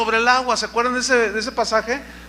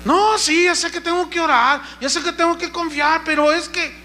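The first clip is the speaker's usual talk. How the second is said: whiny believer; 8 semitones higher than usual. Retold or imitated. Mocking a complaining believer.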